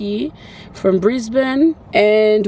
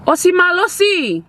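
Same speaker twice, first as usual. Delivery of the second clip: high singsong cheer of a nickname; playful calling out; 7 semitones higher, 3 dB louder than usual. Pretend. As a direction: call out teasingly.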